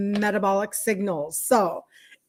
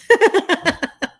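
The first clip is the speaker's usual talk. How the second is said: loud, high laugh; amused laugh at your own remark; 9 semitones higher, 6 dB louder than usual. Real, not acted. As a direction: burst out in real giggles. Laughs after saying she was right.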